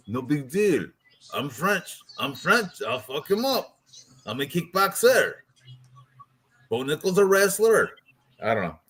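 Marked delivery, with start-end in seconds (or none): 0.1-0.9 mock French accent, shrugging off the opponent; bad French accent. Podcaster voicing fighter sizing up opponent, bad French accent. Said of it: "I can't do a French accent either". 1.3-7.9 higher-pitched, badly faked French accent. Podcaster voicing fighter sizing up wrestler, bad French accent. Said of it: "I can't do a French accent either".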